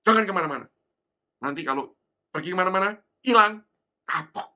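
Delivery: loud mother's warning, slowing at the end; stern warning. Retold or imitated. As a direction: warn the child sharply like a mother. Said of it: "tapi dengan peringatan". (ibu memperingatkan anaknya dengan keras)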